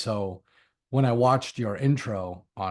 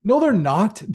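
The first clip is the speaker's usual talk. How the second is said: higher and louder, emphatic no; emphatic denial; 10 semitones higher, 8 dB louder than usual. Real, not acted. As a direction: knock the myth down with a bright, loud no. Debunking bats are blind myth.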